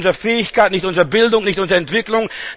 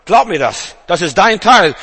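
ordinary talk, nothing marked

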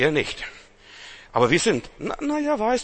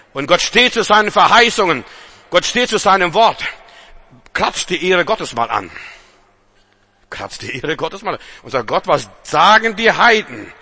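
loud, raised preaching voice; rally the congregation, loud and high; fiery sermon cry; preaching at full force; 5 semitones higher, 6 dB louder than usual. Acted.

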